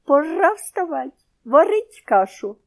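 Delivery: high, slow squawky parrot words. A narrator's reading. Parrot nestles against the boy and recites. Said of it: "притулився до його грудей і сказав".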